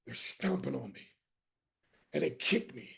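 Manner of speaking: low, very soft recollection; hushed, somber. Real, not acted. Survivor recounting being kicked, hushed and low. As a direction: drop suddenly to a low, grave hush. Drops low reliving the beating.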